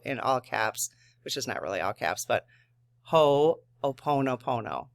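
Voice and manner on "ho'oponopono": Ho'oponopono stretched out slowly, each syllable ho-o-po-no-po-no clear; slow pronunciation. Shown as a demonstration. Reading the word's pronunciation guide to viewers, slow and deliberate. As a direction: spell out Ho'oponopono slowly by ear. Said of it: "she has the pronunciation right there".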